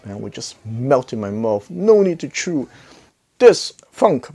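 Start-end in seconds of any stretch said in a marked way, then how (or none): none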